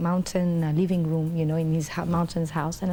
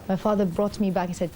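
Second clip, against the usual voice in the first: ordinary delivery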